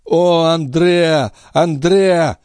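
rising to high, drawn-out cries of the name; desperate anguish. A narrator's reading. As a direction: call out the lost name in anguish. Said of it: "из груди его вырвалось отчаянное рыдание". (отчаянный, горестный возглас)

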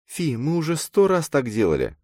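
put-on high girl's voice, unimpressed; dismissive girl character. A narrator's reading. Girl turns down her friend's photo idea, dismissive.

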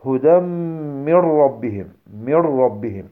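"من ربهم" as "mir-rabbihim", doubled R; noon merged into raa. Shown as a demonstration. Teacher giving raa example, noon merged into raa. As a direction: recite "هدى" nasally into "من", then "mir-rabbihim". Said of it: "بالنسبة للراء".

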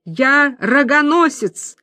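put-on slow, drunken blubbering; drunk crying. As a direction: wail it out drunk and weepy. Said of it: "пьяно рыдая".

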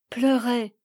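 ordinary delivery

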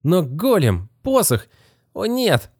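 put-on high, despairing young boy; boy realizing all is lost; dismayed boy. A narrator's reading.

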